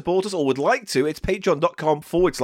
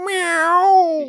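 much higher, drawn-out meow; cat meowing; 11 semitones higher, 4 dB louder than usual. Acted. The cat Biscuits greets them with a high meow.

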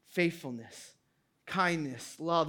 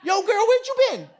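put-on much higher, playful "yo girl" greeting; casual street greeting; 7 dB louder, faster than usual. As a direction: hail her like a buddy on the street, joking. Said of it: "that's the inflection in the Hebrew".

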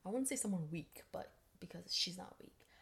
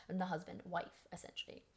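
usual speaking voice

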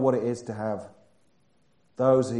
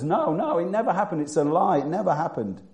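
high-pitched, mocking string of denials; 6 semitones higher than usual. Preacher voicing the Quran's denial of the crucifixion, high mock protest. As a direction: protest in a higher voice, imitating a denier. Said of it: "the Quran says".

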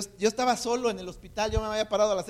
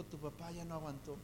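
hushed, lower-volume delivery of bad news; noticia grave en voz baja; 3 semitones lower, 16 dB quieter than usual. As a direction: let the words drop away very softly. Repite la noticia de muerte. (dar la mala noticia en voz muy baja)

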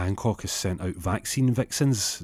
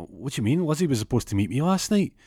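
acted-out confused disbelief; raised, thrown-off questioning; can't make sense of it; bewildered protest; 7 semitones higher than usual. Learns girlfriend was out.

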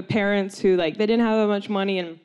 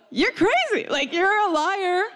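high, strained mock-parent outrage; outraged parents; 11 semitones higher, slower than usual. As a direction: wail the accusation, stretched out and high. Parents accusing her, acted.

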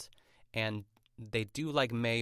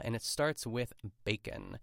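usual speaking voice

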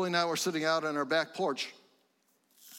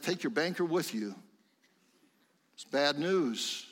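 ordinary talk

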